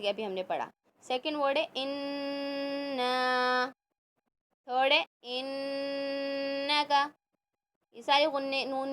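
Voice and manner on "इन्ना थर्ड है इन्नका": इन्ना with the न्न held through the nose; nasal ghunna. Shown as a demonstration. Teacher demonstrating ghunna to students, nasal hum. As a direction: hum the doubled noon. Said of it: "नाक से हम निकालते हैं".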